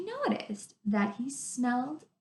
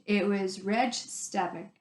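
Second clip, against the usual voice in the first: ordinary delivery, nothing marked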